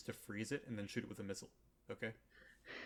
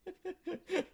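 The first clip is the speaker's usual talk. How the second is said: high laugh. Real, not acted. Laugh at Adam killing child.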